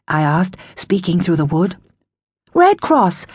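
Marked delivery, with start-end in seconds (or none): 2.5-3.3 higher, sweet stranger's voice. Acted. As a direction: sound kind and harmless through a door. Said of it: "a woman's sweet voice replied".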